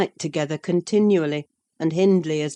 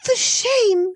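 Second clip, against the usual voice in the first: high, louder burst of indignation; angry outcry; 7 dB louder than usual. Acted. Isabella rebuking Catherine, high and indignant. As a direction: fling the words at her. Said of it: "she repeated angrily".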